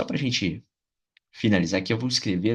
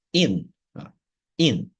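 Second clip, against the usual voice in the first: "in" isolated and punched as the strong part; strong first syllable; 4 semitones higher, slower than usual. Shown as a demonstration. Teacher showing the first part, "in" stressed. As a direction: give "in" firm, separate emphasis. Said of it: "vai ser a mais forte".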